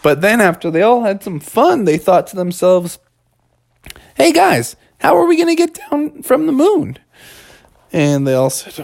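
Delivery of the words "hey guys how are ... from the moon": put-on high-pitched worried kid voice; kid character. Kid character addresses the others.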